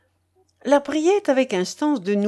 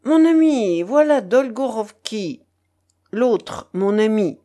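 loud, declamatory introduction; pompous announcement; 2 semitones higher, 6 dB louder than usual. A narrator's reading. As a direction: announce it grandly, phrase by phrase. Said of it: "prononça l'encombrant personnage en toisant Lambert".

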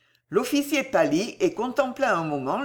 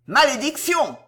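high, raised curse; crying out; 7 semitones higher, 4 dB louder than usual. A narrator's reading. Officer running to the fallen child, crying out. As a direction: exclaim in dismay. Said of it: "s'écria l'officier en accourant".

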